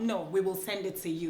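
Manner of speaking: usual speaking voice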